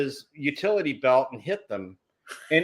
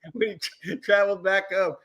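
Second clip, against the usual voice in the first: ordinary delivery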